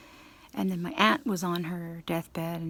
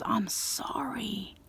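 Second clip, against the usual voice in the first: slow, breathy whispered apology, close to tears; teary apology; 3 semitones higher, 5 dB quieter, much slower than usual. Retold or imitated. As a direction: whisper the sorry slowly as if choking up. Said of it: "he even got teary-eyed".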